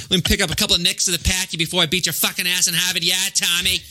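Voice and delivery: thick Boston tough guy; heavy townie Boston voice; Boston accent. Acted. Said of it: "a good depiction of a Boston accent".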